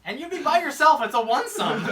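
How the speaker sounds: loud, high-pitched punchline; mock taunt. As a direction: pile on with a hammed-up jeer.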